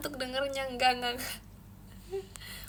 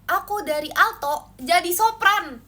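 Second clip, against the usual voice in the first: high soprano demo; 5 semitones higher, 9 dB louder, slower than usual. Shown as a demonstration. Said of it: "suara aku cempreng banget".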